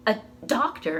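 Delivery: plain reading voice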